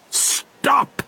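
high-pitched command to halt; calling out. Acted. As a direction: call it out high and sudden. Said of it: "called Hank Chief".

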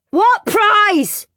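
high insistent shout. Acted. Henry shouting at teacher, high demanding shout. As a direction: call across the classroom. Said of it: "and shouted".

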